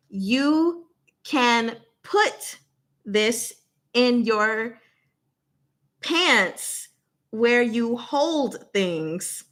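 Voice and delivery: very slow, deliberate clue-giving; speaking slowly. Shown as a demonstration. Host giving a one-syllable clue to chat, very slow. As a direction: stretch each word out so viewers catch every syllable. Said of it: "I'll speak slowly as well".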